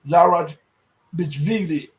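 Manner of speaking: Zarachvili sounded out very slowly, syllable by syllable; careful pronunciation. Real, not acted. To viewers, slowly sounding out a hard Georgian name. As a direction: drag out Zarachvili as he fumbles the name. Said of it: "it's hard to pronounce those names".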